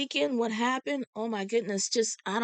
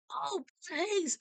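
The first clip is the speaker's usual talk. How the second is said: recognition; 10 semitones higher, 4 dB quieter than usual. Retold or imitated. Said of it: "he said".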